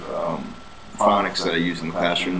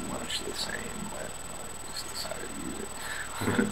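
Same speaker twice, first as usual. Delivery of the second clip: whispered aside; 9 semitones lower, 13 dB quieter than usual. Real, not acted.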